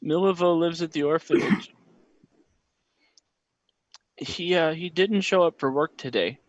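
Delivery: hint of a timid child; lightly voiced young boy. Boy tells about bully.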